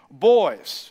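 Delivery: put-on loud stern call to the kids; a dad's loud warning call. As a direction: call the boys to order like a fed-up dad. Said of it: "You know that dad voice that just comes out?".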